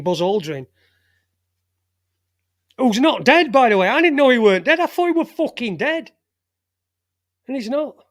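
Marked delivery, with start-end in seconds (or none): 2.8-8.0 louder, higher, amazed he's still alive; half-laughing disbelief; surprised. Real, not acted. Radio host telling listeners, surprised and loud.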